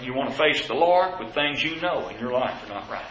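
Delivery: normal talking voice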